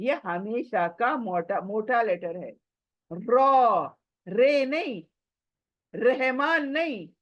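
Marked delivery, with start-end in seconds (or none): 3.1-3.9 रॉ said full-mouthed with a rounded o; thick रॉ, not रे; heavy ra. Shown as a demonstration. Teacher demanding the heavy Arabic ra. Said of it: "ये मोटा letter है".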